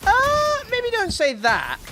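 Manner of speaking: high, loud "uh", then drawn-out caution; high-pitched, slow warning; alarmed protest. Real, not acted. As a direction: jump in high to head it off. Alarmed at threat of violence.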